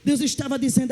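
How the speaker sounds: ordinary talk, nothing marked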